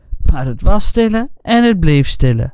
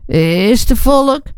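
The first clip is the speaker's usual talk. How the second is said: slow, timid, higher question; anxious voice; 7 semitones higher, much slower than usual. Acted. Frightened farmhand behind the door, asking timidly. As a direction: ask it fearfully from behind the door. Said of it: "een benauwde stemme".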